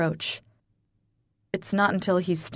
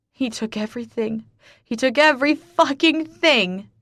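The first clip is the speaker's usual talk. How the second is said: rising from hurt to loud, high anguish; building despair; anguished outburst; 7 semitones higher, 4 dB louder than usual. Acted. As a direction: break down into it. Grieving outburst on her knees.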